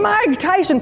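high joyful shout; shouting together. Retold or imitated. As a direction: chant it with glee. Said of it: "both of us were shouting".

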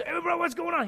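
loud, high hearty greeting; really loud. Retold or imitated. Pastor imitating Ronnie greeting him, loud and high. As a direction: bellow a friendly hello. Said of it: "He was really loud".

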